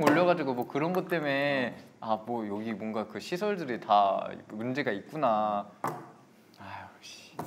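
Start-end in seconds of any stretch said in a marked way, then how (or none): 5.8-7.3 whispered curse. Real, not acted. Muttered curse after scare.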